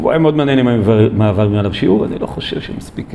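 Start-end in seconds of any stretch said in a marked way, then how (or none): 2.0-3.1 a voiceless side remark; whispered aside. Real, not acted. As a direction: drop to a whisper for a private aside. Muttered aside mid-lecture.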